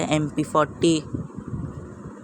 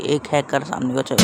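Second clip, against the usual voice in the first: usual speaking voice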